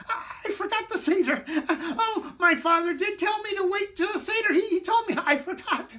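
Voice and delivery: very high, giggly squeak; a tiny excited figure squeaking; squeaky voice. Acted. Matzo man remembering the Seder, high giggly squeak. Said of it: "He squeaked".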